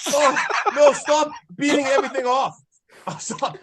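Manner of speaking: yelled. Real, not acted. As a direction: yell at them to stop. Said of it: "I can't believe I just yelled that".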